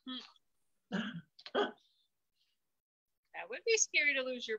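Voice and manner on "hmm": usual speaking voice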